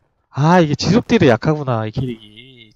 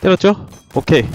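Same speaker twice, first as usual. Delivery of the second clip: normal talking voice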